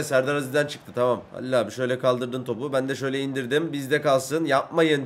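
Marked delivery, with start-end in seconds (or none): none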